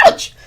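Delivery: loud sudden yelp; pained ouch. Acted. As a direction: yelp at the bump. Leg bumps tree wall.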